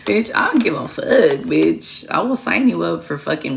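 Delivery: half-joking loud threat; mock scolding. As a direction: snap at her like an exasperated mom. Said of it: "I was like".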